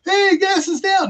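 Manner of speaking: high, slow mocking voice; put-on voice of a naive person; mock announcer voice. Mocking someone's claim.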